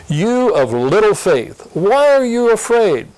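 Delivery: a hint of Jesus' gentle rebuke, rising on why; lightly voiced Jesus questioning the disciples; Jesus rebuking. Jesus to his frightened disciples, lightly voiced rebuke. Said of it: "In verse twenty-six, he says".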